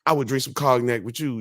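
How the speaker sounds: high, excited imitation of a pumped-up friend; mock-excited athlete offering a celebration drink; imitating Ocho Cinco.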